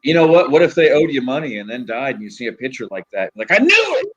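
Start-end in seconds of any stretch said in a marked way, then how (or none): 3.5-4.1 high, loud exclamation of vindication; mock outcry. Seeing the dead debtor's picture, mock triumphant yell. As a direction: burst out in pretend triumph, pitch way up.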